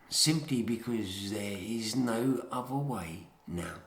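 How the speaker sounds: ordinary delivery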